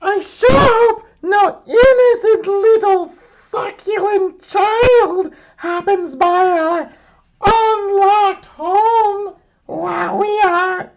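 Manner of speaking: very high, loud villainous monster mom; hungry monster gloating over a child; greedy Mama Dinosaur. Acted. Mama Dinosaur to family, gloating about eating a child, very high and loud.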